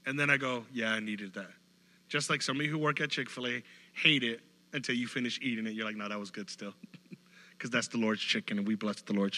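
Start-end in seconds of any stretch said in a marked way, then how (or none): 0.7-1.5 low, soft contented admission; quiet satisfied sigh. Retold or imitated. Content after finishing Bible reading. 6.0-6.7 low, much softer concession; soft grudging admission. Retold or imitated. Grudging admission after eating.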